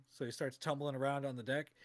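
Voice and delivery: ordinary delivery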